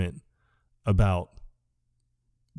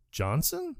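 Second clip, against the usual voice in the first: soft, high questioning echo; small, rising voice of confusion; mock puzzlement; 10 semitones higher, 7 dB quieter than usual. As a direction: repeat it as a baffled question. Plays Dude's puzzled echo.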